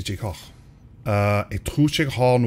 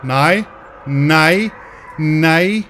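loud, higher, panicked nos; real alarm; frightened no; 6 semitones higher, 5 dB louder, much slower than usual. Real, not acted. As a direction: cry out in fear. Monster approaches again.

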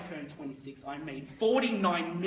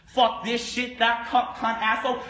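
high, loud volley of curses; trading-floor yelling; 7 semitones higher, 11 dB louder than usual. Retold or imitated. Imitating Wall Street traders cursing each other, loud yelling. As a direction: shout it across a trading floor. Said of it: "how these guys talk to each other".

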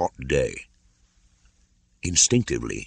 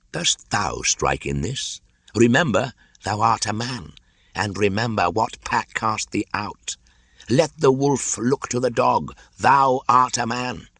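put-on old python's stern raised warning; Kaa, warning; 7 semitones higher than usual. A narrator's reading. Kaa warning Mowgli he is a man, stern. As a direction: remind the boy what he is.

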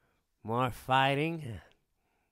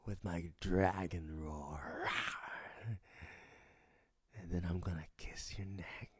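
breathy whisper right at the ear; seductive whisper; 6 semitones lower, 8 dB quieter than usual. Acted. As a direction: whisper it close and low as if kissing the neck. Intimate promise whispered at the ear.

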